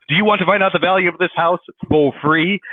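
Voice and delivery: hammed-up bullhorn sales pitch; megaphone voice. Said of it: "like, a megaphone".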